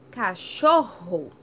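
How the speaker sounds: "Cachorro" slow and loud, rr drawn out; strong rr. Shown as a demonstration. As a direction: stretch the rr in "Cachorro". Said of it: "Teve um som forte". ("Cachorro" devagar e alto, com rr forte)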